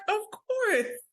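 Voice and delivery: high-pitched imitated "obviously"; emphatic assurance. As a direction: sing out an emphatic yes. Voicing cousin's emphatic reassurance.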